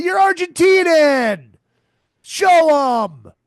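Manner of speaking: loud exasperated demand; indignant shout. Real, not acted. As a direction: shout it at the TV in protest. Yelling at soccer fans on TV.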